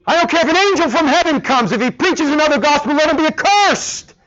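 high, loud, fast furious outburst; acted apostle in full fury; holy anger. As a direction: rage at the false gospel from the pulpit. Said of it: "a tone of anger".

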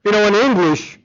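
ordinary delivery

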